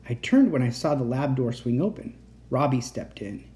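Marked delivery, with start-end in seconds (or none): none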